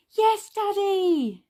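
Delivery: high, drawn-out cheer; excited cry. A narrator's reading. Children answering Daddy about the dadventure, excited cry. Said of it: "they cried, giggling".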